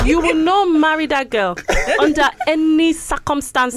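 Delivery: loud, high Nigerian mother scolding; Nigerian accent. Pretend. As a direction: tell off a son in mock-Nigerian fury. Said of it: "I'll give you Nigerian".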